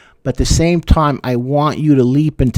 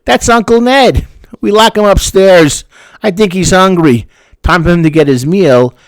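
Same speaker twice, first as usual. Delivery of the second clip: higher and louder, breezily explaining the locked-up uncle; casual father; 6 semitones higher, 5 dB louder than usual. Retold or imitated. Father tells the guest about Uncle Ned, high and loud. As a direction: play a dad calmly explaining away something creepy. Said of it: "the father looks at you and says".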